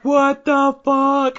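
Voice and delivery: mock baffled listener. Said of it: "people are like".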